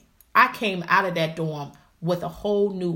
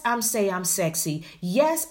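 ordinary delivery

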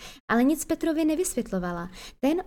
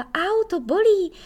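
high, loud yelp of pain; sharp high ouch of pain; sudden pained cry; 9 semitones higher, 5 dB louder, slower than usual. Acted. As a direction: cry out as your hair is yanked. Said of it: "Najednou poskočila a vykřikla".

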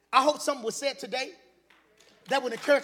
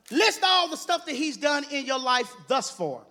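normal talking voice

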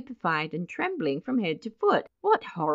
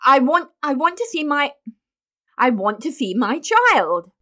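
loud, high, broken restarts; stumble, then force the demand out loudly; faltering, desperate; 7 semitones higher, 7 dB louder than usual. A narrator's reading. Mister Button repeating his demand upstairs, faltering and desperate. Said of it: "turned falteringly".

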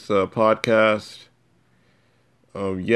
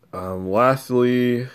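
a slow, drawn-out lastly; 4 semitones higher, much slower than usual. Acted. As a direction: stretch out the word slowly. Drawn-out lead into final point.

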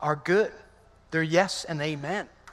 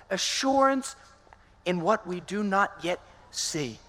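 ordinary delivery

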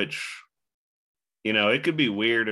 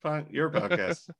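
mock mailman voice; 7 semitones higher, 4 dB louder than usual. Playing the mailman mid-interview.

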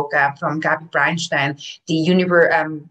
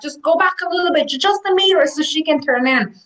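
helpful drivers; 9 semitones higher than usual. Retold or imitated.

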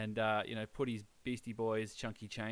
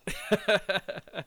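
loud laugh; 9 semitones higher, 9 dB louder than usual. Real, not acted. Host laughs loudly.

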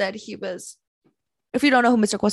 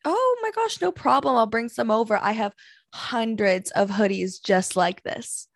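mocking ex-boyfriend voice. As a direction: gush it like a smug con man. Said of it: "and I quote".

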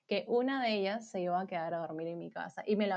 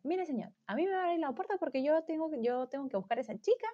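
fast, higher, insistent demand; fed-up mother; 4 semitones higher, 5 dB quieter, faster than usual. Retold or imitated. Angry mother to club security guard, quick insistent imitation. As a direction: imitate a determined mom talking fast without pause.

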